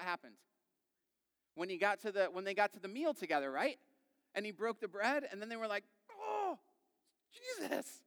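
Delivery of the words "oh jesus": very high, hammed-up realization; awed gasp. Disciples recognizing Jesus at the meal, acted astonishment. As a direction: gasp it high, then pause before the name.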